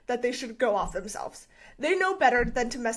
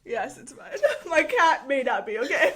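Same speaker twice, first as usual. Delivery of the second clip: normal talking voice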